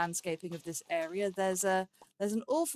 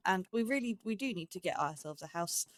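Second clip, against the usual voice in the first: ordinary delivery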